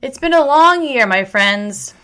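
"It's been a long year" pushed out high and loud; emotional outburst. Real, not acted. Telling her viewers, swept up in emotion, loud and high. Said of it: "I'm getting really emotional".

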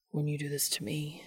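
low whispery murmur; mumbled. A narrator's reading. Dean close to Cass's ear, mumbling low. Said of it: "Dean mumbles close to Cass's ear".

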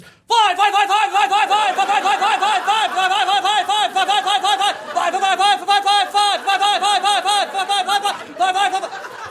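high squawked fly over and over; relentless high crow chant of fly; mock crow squawking. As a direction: squawk it like a crow forcing babies out. Mocking crows teaching flight.

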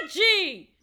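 high, drawn-out cry of a name; mock sleep scream. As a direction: call out as a kid screaming while asleep. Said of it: "I screamed a name in my sleep".

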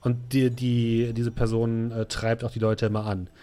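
ordinary delivery

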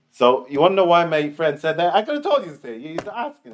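retold retort, rising to a high peak; animated retort. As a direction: pitch climbing high in explanation. Acted grandson explaining his friend's remark.

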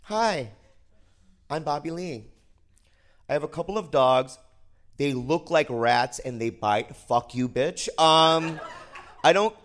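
half-hearted spoken word delivery; mock slam poet, slow and dramatic; slam poetry. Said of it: "Spoken word style".

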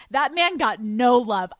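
ordinary delivery

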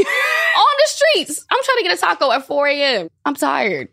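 high, hammed-up exasperated whine; comic high-pitched grievance; mock indignant. Jokingly replaying her street argument.

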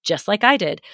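ordinary talk, nothing marked

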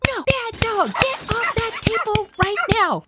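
high-pitched mock scolding of the dog; mom's high, frantic voice chasing the dog off the table. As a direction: shriek at the dog like an upset mom. Mom yelling at dog in dining.

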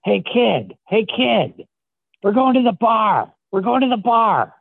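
high-pitched impression of an old-timer; put-on high voice, rallying a kid; Philadelphia Eddie voice. Shown as a demonstration. Old tattooer calling a kid out to the bar, high put-on voice. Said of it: "a Eddie voice?".

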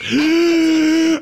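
loud high sobbing gasp; hammed-up horrified gasp; mock dramatic gasp. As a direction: gasp as if back from hell. Mocking jet-lag trauma.

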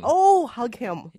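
slow, swooning oh; dreamy excited gush. Real, not acted. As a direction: melt over the idea of hugging him. Excited fan gush at Bill Murray.

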